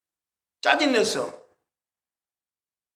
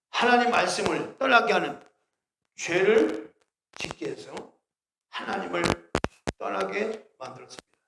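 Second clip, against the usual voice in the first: gospel presentation. Shown as a demonstration. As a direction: present it like a street preacher, deliberate. Demonstrates one-minute gospel message.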